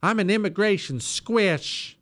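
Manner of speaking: lightly mocking imitation; mock politician quote. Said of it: "Low T Langford says this all the time".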